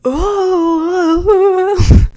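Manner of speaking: high, loud drawn-out oohs; crowd ooh. Retold or imitated. Bar crowd reacting to water poured on Kelly's head, loud high oohs. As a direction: ham up the shocked crowd reaction. Everyone around starts going.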